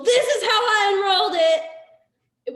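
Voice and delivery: slow, loud and high with a laugh; demo yelling. Shown as a demonstration. Distributor showing her team how to announce an enrollment, loud laughing yell. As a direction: belt it out like an excited team cheer. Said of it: "like, yelling".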